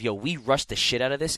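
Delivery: ordinary talk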